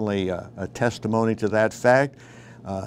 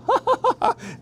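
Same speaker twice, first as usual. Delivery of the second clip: mocking laugh. Mocking snicker after drug quote.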